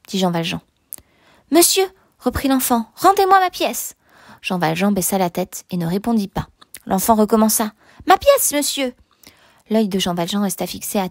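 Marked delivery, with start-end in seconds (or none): 1.5-1.8 put-on higher-pitched little boy; pleading child. A narrator's reading. Little Savoyard appealing to Jean Valjean, pleading child. 2.9-3.9 put-on high little-boy plea; insistent child. A narrator's reading. Little Savoyard wanting his coin back, insistent child. 8.1-8.9 put-on higher little-boy voice; insistent child. A narrator's reading. Child repeating himself to the silent man, insistent.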